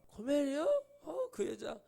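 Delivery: high, plaintive protest in mock dismay; annoyed whine. Hosea hearing of Gomer from God, imitated annoyed whine. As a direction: balk at the name, half crying. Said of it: "짜증내니까".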